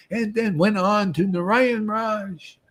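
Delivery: usual speaking voice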